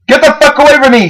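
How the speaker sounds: high-pitched panicked cry. A narrator's reading. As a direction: scream her away from you in panic. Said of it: "she said in a panic".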